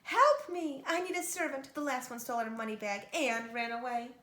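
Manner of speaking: put-on grab the girl and cry out. Giantess grabbing Kate at the door, loud cry. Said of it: "grabbed Kate and cried".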